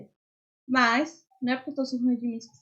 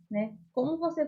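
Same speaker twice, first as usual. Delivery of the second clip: ordinary talk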